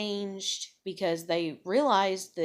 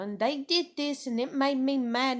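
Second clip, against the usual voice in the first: put-on higher, louder little-girl voice; little tiny voice; 6 semitones higher, 4 dB louder than usual. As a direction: mimic a small child blurting out what happened. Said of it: "she's got this little tiny voice".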